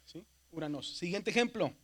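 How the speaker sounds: normal talking voice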